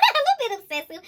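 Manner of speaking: high, fast, laughing repeat. Real, not acted. Tarot reader laughing as she repeats to viewers, high and giggly. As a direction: giggle the words out high and quick.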